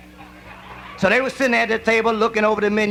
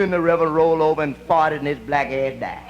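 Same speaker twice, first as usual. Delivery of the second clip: usual speaking voice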